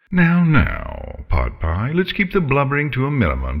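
high, swooping, condescending villain; scolding dragon. Acted. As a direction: chide the weeping meal with breezy menace. Voices the dragon Scarlet.

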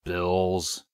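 flat bored monotone. A narrator's reading. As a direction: drone it flat. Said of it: "in a monotone voice".

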